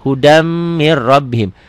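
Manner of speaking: slow "Hudam mir rabbihim" with held m and doubled r; idgham reading. Shown as a demonstration. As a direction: stretch both merges in "Hudam mir rabbihim". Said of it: "Tulisan dan bacaan beda". ("Hudam mir rabbihim" pelan, m ditahan, r ganda)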